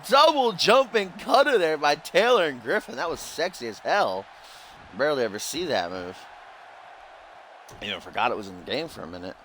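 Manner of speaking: lively wrestling commentator call; play-by-play commentary. Acted. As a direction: call the match like a ringside announcer.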